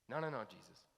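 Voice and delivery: ordinary talk, nothing marked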